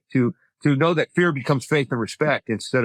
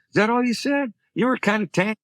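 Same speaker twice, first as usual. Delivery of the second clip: high-pitched goading; playful impression of a taunting ex; imitating teasing ex-wife; 6 semitones higher than usual. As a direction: needle them in a raised voice. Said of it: "So she's like that".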